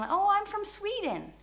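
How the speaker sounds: high-pitched mock small talk; chatty foreigner. Foreigner introducing themselves at a bar table, high and chatty. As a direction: lift the voice high, playing a friendly stranger. Acting out newcomer's friendly answer.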